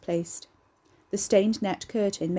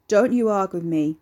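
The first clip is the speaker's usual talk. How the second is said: low and dangerous; 6 dB louder than usual. Acted. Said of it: "rumbled low and dangerous".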